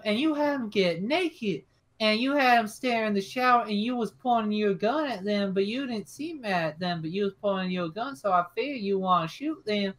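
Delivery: put-on high, stammering child voice; scared little boy. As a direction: ramble out a nervous confession like a kid. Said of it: "And Billy's just sort of".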